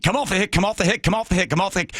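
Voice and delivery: high, insistent repeated call; urgent pilot voice. Retold or imitated. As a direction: call him off the strain. Pilot calling him off.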